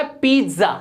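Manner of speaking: पिज्जा with long ee, "PEET-za"; correct pronunciation. Shown as a demonstration. Teacher models for learners, पिज्जा said "peet-za". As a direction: stretch the first vowel of पिज्जा. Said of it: "ये होता है".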